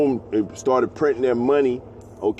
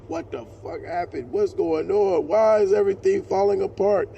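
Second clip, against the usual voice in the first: awestruck panic; 9 semitones higher than usual. Retold or imitated. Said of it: "Jake is gonna be awestruck, like".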